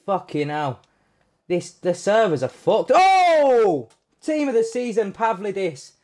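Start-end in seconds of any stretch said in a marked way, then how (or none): 2.9-5.9 sudden high yelp of excitement; excited outburst. Real, not acted. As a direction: blurt it out high and thrilled. Pack reveal, team of the season.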